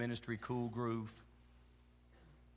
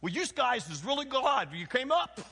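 imitating New Yorkers; 12 semitones higher, 7 dB louder than usual. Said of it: "They go".

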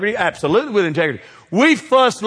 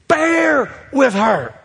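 emphatic shout; 8 semitones higher, 4 dB louder, much slower than usual. Acted. Pastor's answer, repeated louder.

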